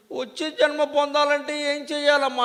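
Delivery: put-on high, slow, a touch of a crying boy; crying boy asking his stepmother, a mild tearful plea; tearful child. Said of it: "ధ్రువుడు ఏడుస్తూ".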